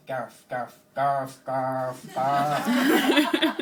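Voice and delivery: mangled name, hammed up to a yell; mock foreign mispronunciation. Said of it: "they say Gareth".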